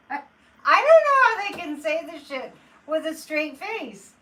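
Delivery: real outraged exasperation; exasperated outburst. Real, not acted. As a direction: vent your disbelief at her lies. Outraged at the press secretary.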